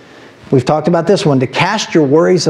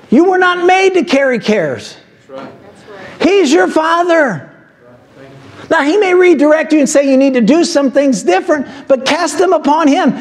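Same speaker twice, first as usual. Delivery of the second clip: preaching at full force; 8 semitones higher than usual. Acted. Pastor exhorts the congregation to cast their cares, preaching at full force. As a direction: preach it with rising fire, slowing the key words.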